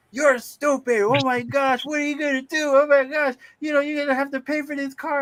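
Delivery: very high put-on scolding voice; scolding auntie. Auntie scolds him over the sunken car, high-pitched.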